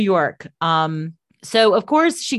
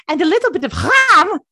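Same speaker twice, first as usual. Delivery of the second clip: higher, louder hammed-up French voice; mock French accent; 11 semitones higher, 6 dB louder than usual. Freshman in a play, hammed-up French accent. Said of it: "French pronounce ham like ham".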